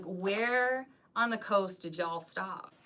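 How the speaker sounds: slow, drawn-out Southern US drawl; Southern accent. Acted. Vlogger copying a friend's beloved accent, slow Southern drawl. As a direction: playfully imitate a Southern accent, stretching the vowels. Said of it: "like, Misty's accent".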